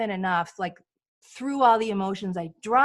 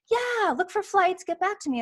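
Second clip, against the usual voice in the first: a hint of a casual sister; lightly voiced sister; 9 semitones higher than usual.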